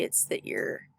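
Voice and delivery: breathy, drawn-out tired of it; slow, whispered weariness; weary whisper. Shown as a demonstration. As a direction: let the words sag, worn out. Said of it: "emotionally worn out".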